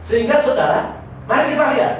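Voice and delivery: high, loud pulpit proclamation; full-force preaching. Acted. A preacher proclaiming to the congregation, full-force preaching. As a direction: proclaim it to the whole hall.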